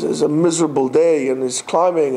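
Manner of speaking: ordinary delivery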